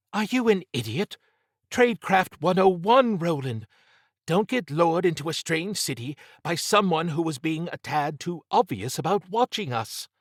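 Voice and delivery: high-pitched, indignant scolding; angry Trish. A narrator's reading. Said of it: "It was rare Trish got angry".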